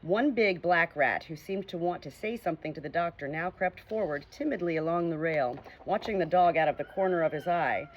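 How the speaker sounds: ordinary delivery, nothing marked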